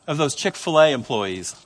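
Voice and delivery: ordinary talk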